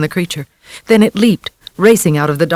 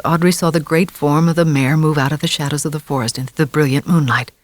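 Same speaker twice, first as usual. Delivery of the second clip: ordinary delivery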